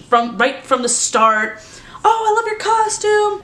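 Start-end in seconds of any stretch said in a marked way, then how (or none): 2.0-3.4 high, sweet gushing compliment; imitating a sweet, bubbly actress. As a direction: gush it like a bubbly fan. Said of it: "Super sweet".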